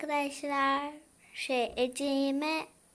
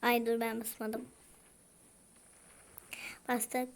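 normal talking voice

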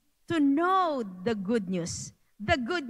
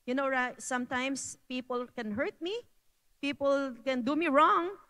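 normal talking voice

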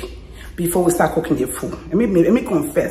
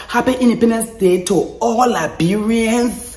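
solemn blessing; 3 semitones higher, 5 dB louder, slower than usual. Real, not acted.

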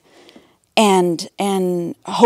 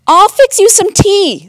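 helpful sister; 10 semitones higher, 5 dB louder than usual. A narrator's reading. Martha offers sick brother tea.

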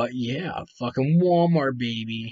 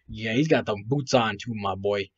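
ordinary talk

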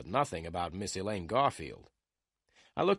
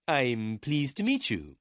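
put-on mildly polite older lawyer, slow and higher; 4 semitones higher, 3 dB louder, slower than usual. A narrator's reading. Lawyer Meyer meets the private detective, lightly voiced politeness. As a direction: greet the visitor with slow, measured courtesy. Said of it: "Mister Meyer said politely".

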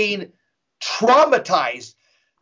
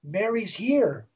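softer, slow quoted announcement; hushed imitation of the wife; retold hushed awe; 2 semitones higher, 7 dB quieter, slower than usual. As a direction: say it quietly, drawn out.

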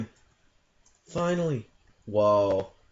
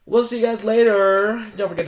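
excited outro; 9 semitones higher, 8 dB louder than usual. Real, not acted. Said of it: "youtuber outro".

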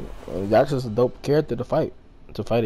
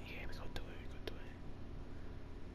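whisper; 21 dB quieter than usual. Real, not acted. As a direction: hush it like a plan being hatched. Plotting the rematch.

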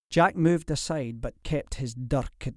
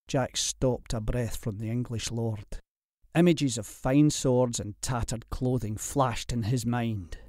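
plain reading voice

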